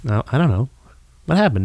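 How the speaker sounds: ordinary talk, nothing marked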